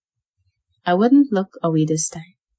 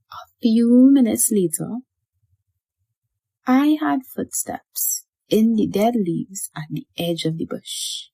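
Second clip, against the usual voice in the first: usual speaking voice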